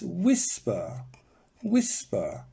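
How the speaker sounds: WHIS-per, drawn out and falling; stress on first syllable of whisper. Shown as a demonstration. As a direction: hit WHIS each time. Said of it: "the stress is whisper".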